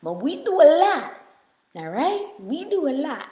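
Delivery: ordinary talk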